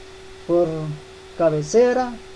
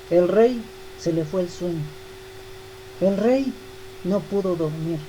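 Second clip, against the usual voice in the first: hushed storytelling; 2 semitones lower, 7 dB quieter than usual. A narrator's reading. Calm sleeping Daniel, sleepless king.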